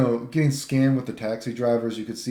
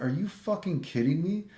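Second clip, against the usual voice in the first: higher, incredulous outburst; indignant disbelief, pitched up; outraged disbelief; 8 semitones higher than usual. Retold or imitated. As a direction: retell the blow-up at the driver, pitched up. Outraged at the taxi scam.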